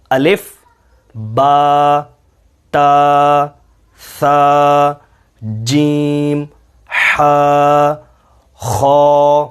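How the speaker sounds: each letter said alone, slowly and with emphasis; a careful recitation of the alphabet; letter drill. Shown as a demonstration. Teacher recites the letters for students to repeat. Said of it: "ان تمام حروف کی سب سے پہلے دہرائی".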